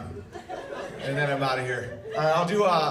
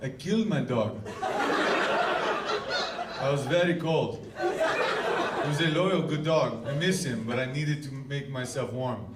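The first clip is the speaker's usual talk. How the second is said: mock Russian accent; 4 semitones lower, 7 dB quieter, slower than usual. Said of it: "any Russian I know is like".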